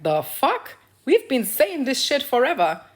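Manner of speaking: exasperated retort. Retold or imitated. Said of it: "Women globally would be like".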